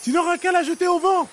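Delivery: very high, loud bird call; seagull cry voice. A narrator's reading. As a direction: cry it out like a gull. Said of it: "cria-t-elle en s'envolant".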